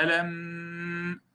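"ألم" with the final م hummed far too long; over-held ghunnah. Shown as a demonstration. Teacher showing students the mistake, over-long hummed م in "ألم". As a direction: drag out the nasal hum closing "ألم". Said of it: "وأطلت هذه الغنة بهذه الكيفية، فهذا خطأ".

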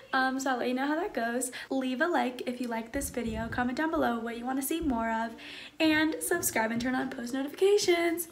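hushed vlog chatter. Real, not acted. Hushed outro.

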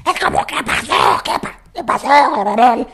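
choked, voiceless squawk of a question; swallowed voice. Shown as a demonstration. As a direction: squeeze the words out from down the throat. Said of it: "se van a tragar la voz".

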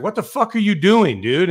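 loud, high, incredulous shout; angry captain shouting; exasperated yell. Retold or imitated. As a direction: bark it at the clueless rookie. Captain yelling at clueless rookie.

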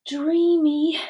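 high, drawn-out dreamy sigh of a word; dreamy swooning. A narrator's reading. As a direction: swoon over your new sweetheart. Dinerella swoons as they dance.

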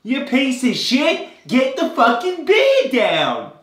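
loud, slow, high mock-Australian scolding; Australian accent. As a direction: bellow it slowly in hammed-up Australian. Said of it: "as Dave's Australian accent".

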